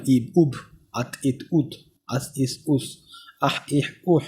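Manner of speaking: each syllable clipped short, ইব, উব, আত stopped dead; teacher sounding out stopped syllables; Arabic sukun drill. Shown as a demonstration. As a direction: read the syllables slowly, stopping on each consonant. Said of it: "দেখুন আব".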